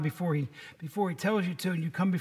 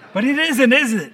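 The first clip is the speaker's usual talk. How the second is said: laughing; 5 semitones higher, 10 dB louder than usual. Real, not acted. Laughs while insisting.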